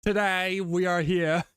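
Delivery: YouTuber impression. Mimics a YouTuber introducing a video, slow and loud. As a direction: stretch out a bright YouTuber greeting. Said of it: "I can't do his accent".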